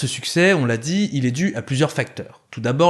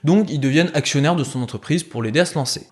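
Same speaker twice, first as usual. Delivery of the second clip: ordinary delivery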